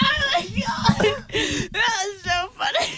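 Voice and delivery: breathless fits of laughter; laughing, barely holding together; losing it laughing. Real, not acted. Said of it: "[name]'s losing it".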